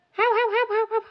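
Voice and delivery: whispered high dog barks; barking dog. Pretend. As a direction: bark like a small dog. (köpek gibi havlama)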